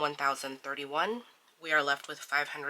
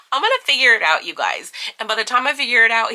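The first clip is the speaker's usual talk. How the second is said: laughing excitement; 6 semitones higher, 13 dB louder, faster than usual. Real, not acted. Laughing about being rushed.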